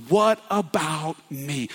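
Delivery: slow, drawn-out plea; slow begging "What about me?"; bitter plea. A narrator's reading. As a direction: ask it as a wounded son. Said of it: "he cried out with a loud and bitter voice".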